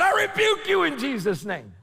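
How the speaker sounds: loud, high rebuking shout; preacher rebuking a robber, loud; bold rebuke. Retold or imitated. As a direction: command it in Jesus' name. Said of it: "That's what I said in Spanish".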